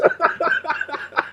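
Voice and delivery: high, breathy chuckle; laughter. Real, not acted. Streamer amused by a touchy topic, soft high laughter. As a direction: laugh softly at your own remark. Laughing at own remark on debates.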